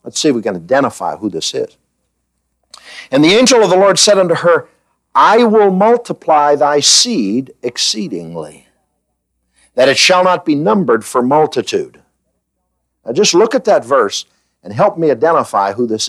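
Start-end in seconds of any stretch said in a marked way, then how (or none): 3.1-12.0 scripture reading. Acted. Reads scripture aloud.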